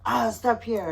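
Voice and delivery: loud, high mock drunk yell at the driver; drunken shout. Drunk husband yelling at the driver to stop. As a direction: bark the order like a drunk rich guy. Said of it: "kind of screaming at the guy".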